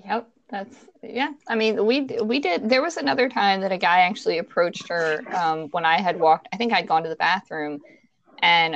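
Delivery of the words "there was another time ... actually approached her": normal talking voice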